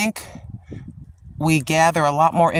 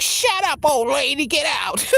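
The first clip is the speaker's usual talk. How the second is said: Tim's reply; 9 semitones higher than usual. Pretend. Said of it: "and you're like".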